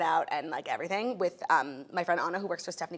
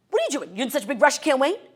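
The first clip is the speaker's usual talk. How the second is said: loud, raised mock scolding; still yelling agent; 5 semitones higher, 7 dB louder than usual. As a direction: imitate an official berating someone publicly. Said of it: "literally still yelling at me".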